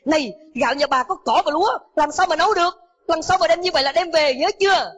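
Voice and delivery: angry shout. Acted. Cook scolding the poor mother, angry shout. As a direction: bark at her like a rude kitchen boss. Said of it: "ông hét lên".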